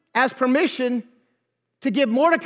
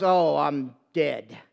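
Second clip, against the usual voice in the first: slow, low, sinking mock dismay; doomed realization; 10 semitones lower, 4 dB quieter, slower than usual. Haman sees the enraged king leave, doomed dismay. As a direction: let it sink like all is lost.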